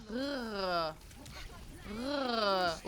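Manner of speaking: drawn-out rolled "rrr"; rolled R attempt. Shown as a demonstration. Streamer showing a tongue-rolling lesson, trilled "rrr". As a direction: roll a long "rrr" on its own. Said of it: "how to roll my tongue".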